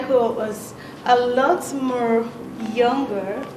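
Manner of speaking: ordinary talk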